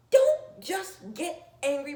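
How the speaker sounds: usual speaking voice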